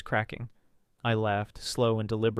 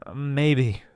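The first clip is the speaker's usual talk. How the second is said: purring; 2 semitones higher, 4 dB louder than usual. A narrator's reading. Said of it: "I purred, voice syrupy".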